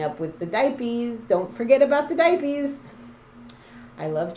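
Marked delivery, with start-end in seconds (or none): 1.3-2.9 higher and louder, teasing him; a higher, louder, teasing reminder about diapers; teasing high warning. Real, not acted. Teasing warning to the new father.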